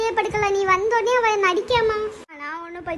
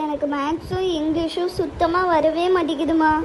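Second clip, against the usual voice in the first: usual speaking voice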